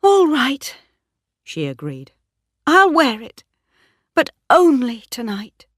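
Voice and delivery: Bathsheba giving way; reluctant yielding. A narrator's reading. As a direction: give in because there's no way out. Bathsheba refuses then accepts ring.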